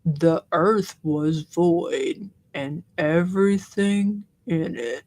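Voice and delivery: deep, very slow Southern monotone; Drew's monotone Southern voice. Acted. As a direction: read scripture like a sleepy ASMR bedtime Bible. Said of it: "let me do my Drew voice".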